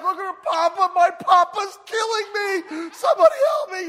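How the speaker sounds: high, loud, slowed screaming for help; hysterical child. Retold or imitated. Boy pulled under by grandpa, screaming for help in hysterical panic. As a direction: yell that Papa is killing you.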